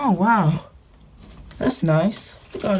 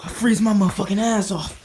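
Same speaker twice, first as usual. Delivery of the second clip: usual speaking voice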